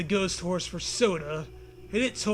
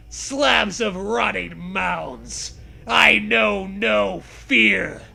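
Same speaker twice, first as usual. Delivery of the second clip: slow dramatic declaiming; 5 dB louder, much slower than usual. Acted. Dramatic zombie-book monologue.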